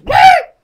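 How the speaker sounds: loud, high-pitched blurted screech; piercing burst of noise; sudden imitated screech. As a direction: imitate a car screeching past you. Imitates the near-miss car in story.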